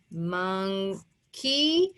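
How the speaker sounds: "Monkey" slowly split as "mon", gap, long "key"; syllable-by-syllable "Monkey". Shown as a demonstration. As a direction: stretch "Monkey" into two syllables, ending on a rise. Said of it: "at the end of a two-syllable word".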